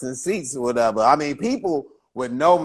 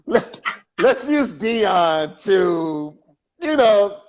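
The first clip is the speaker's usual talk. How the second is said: higher, slow put-on promoter voice, drawn out; mock promoter pitch; 6 semitones higher, slower than usual. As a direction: lightly play the pushy promoter selling a break. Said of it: "they would be like".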